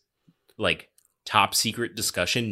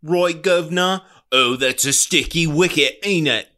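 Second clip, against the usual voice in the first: loud, higher-pitched cartoon Cockney; mock Cockney; 7 semitones higher, 6 dB louder than usual. Host mocking lines written for foreigners by Americans, hammed-up Cockney. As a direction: ham up a stock Cockney character, loudly. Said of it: "Can you do a Cockney accent?".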